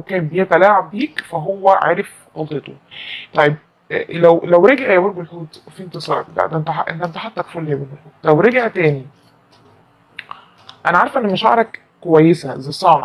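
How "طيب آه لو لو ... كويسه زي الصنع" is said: usual speaking voice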